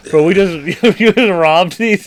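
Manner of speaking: giggling through the words; laughing disbelief. Retold or imitated. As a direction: laugh while you accuse her. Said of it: "I was like".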